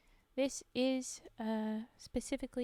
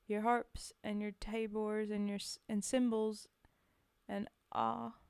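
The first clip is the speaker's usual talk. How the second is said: put-on Scottish-accented poetry reading; halting attempt at a Scottish accent. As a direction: read the verse in a measured Scottish accent. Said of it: "This one is an accent one".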